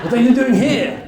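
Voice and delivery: loud, high, hammed-up French accent; bad French accent. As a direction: challenge the intruder in a badly faked French accent. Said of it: "that's a bad French accent".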